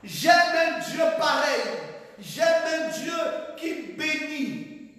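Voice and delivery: loud, high joyful proclamation; preaching exclamation. Real, not acted. Preacher to his congregation, loud high exclamation. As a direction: call it out to the church.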